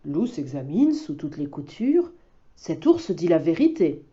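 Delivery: usual speaking voice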